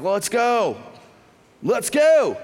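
high, building eager call; rallying call. Retold or imitated. Retold group rallying to leave.

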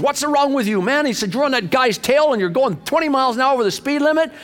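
high-pitched mock rant of a ticked-off patrolman; imitated angry yelling. Patrolman scolds a speeding driver, high-pitched mock yelling. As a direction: act out an irate officer's scolding. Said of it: "started yelling at Doug".

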